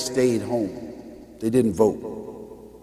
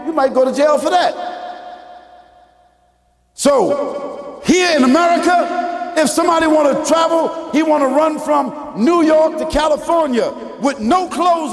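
preaching at full force; 11 semitones higher than usual. Acted. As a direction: drive the point home to the congregation.

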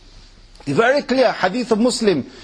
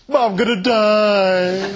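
whiny mock lament; 2 semitones higher, slower than usual. Girl's dramatic self-pity quoted.

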